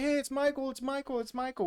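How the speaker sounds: high, retold panicky chant of the name; mock panicked crowd. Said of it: "starts to panic".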